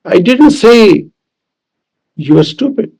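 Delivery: emphasize "say", pause after it; stress on SAY. Shown as a demonstration. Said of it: "depending on which word is emphasized".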